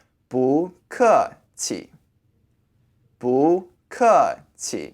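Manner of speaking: 不客气 slowly, with a light neutral last syllable; neutral final tone. Shown as a demonstration. Teacher putting the phrase together, neutral tone on 气. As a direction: separate each syllable of 不客气, leaving 气 toneless. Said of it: "you can say it with a neutral tone".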